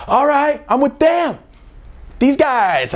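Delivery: hyped self-talk way up high; cocky excitement. Retold or imitated. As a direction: puff up like the big kid. Cocky inner voice entering party.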